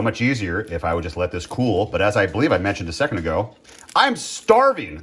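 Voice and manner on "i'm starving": dramatic whine. Real, not acted. Complaining about waiting for food.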